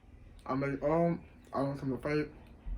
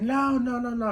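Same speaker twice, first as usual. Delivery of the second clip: high, rapid-fire refusal; alarmed protest; 9 semitones higher, 6 dB louder, slower than usual. Retold or imitated. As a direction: try to halt an intruder in a panic. Blocking sister storming in.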